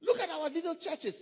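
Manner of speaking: ordinary talk, nothing marked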